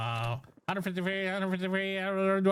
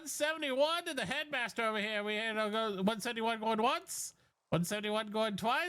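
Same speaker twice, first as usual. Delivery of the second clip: fast auction patter calling bids; auctioneer; 5 semitones higher, 4 dB quieter than usual. Acted. Auction continues.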